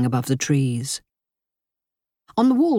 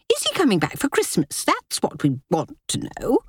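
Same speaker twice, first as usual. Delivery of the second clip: high, snappish society lady; haughty sister-in-law; 6 semitones higher than usual. Acted. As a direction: press with sharp impatience. Linda demands an answer.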